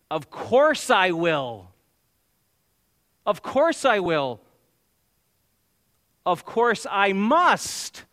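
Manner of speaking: "Of course I will", slow, loud and drawn out; emphatic affirmation. Acted. Jesus accepting the cup, "Of course I must" slowly and emphatically affirmed. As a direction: build to a high, stretched "must" in "Of course I must". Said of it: "It underscores an emphatic affirmation".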